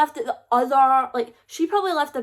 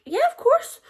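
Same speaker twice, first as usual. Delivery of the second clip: eager yes; 3 dB louder than usual. Retold or imitated. Eager agreement to Lily.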